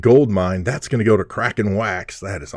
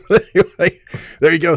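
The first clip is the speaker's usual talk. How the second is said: higher and louder, through a laugh; 8 semitones higher, 3 dB louder, much faster than usual. Real, not acted. Breaker laughs off his teasing of viewers. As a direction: laugh it off and move on.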